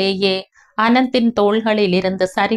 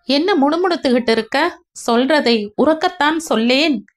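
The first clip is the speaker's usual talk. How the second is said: higher, fast impatient brother; impatient Vijay; 6 semitones higher, faster than usual. Acted. Elder brother demands his muttering brother speak up, impatient and fast. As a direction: push him to speak up, quickly.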